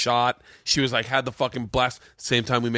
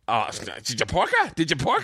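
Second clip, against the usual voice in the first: Captain Brian; 2 semitones higher than usual. Retold or imitated. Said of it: "Captain Brian's like".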